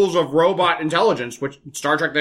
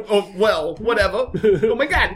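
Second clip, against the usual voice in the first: ordinary talk, nothing marked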